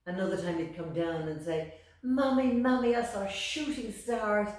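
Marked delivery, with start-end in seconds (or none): 2.0-4.5 put-on high, loud, eager little boy; bursting to tell his mammy; excited child. Little Amal excitedly telling his mammy about the moon.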